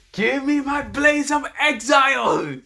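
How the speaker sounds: high, slow, drawn-out scream. Acted. Hero summoning a weapon that won't work, screaming. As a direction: scream the command at the top of your range. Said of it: "he screams".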